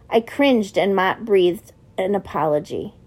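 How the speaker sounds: plain reading voice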